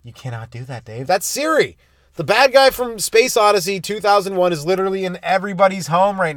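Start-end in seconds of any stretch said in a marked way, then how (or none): 0.0-1.0 lightly voiced HAL. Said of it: "Remember Hal?".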